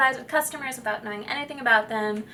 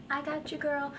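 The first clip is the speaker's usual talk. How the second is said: inner-voice aside; 4 semitones higher, 9 dB quieter than usual. Pretend. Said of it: "that's the little person talking in my head".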